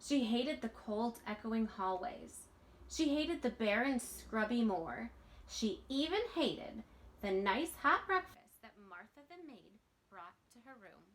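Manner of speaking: put-on lively read-aloud voice for children; storybook reading. A narrator's reading. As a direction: read it like a bedtime picture book. Reading picture book aloud.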